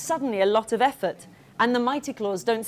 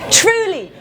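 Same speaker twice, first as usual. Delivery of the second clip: excited; 9 semitones higher, 10 dB louder than usual. Real, not acted. Teacher praising child's answer.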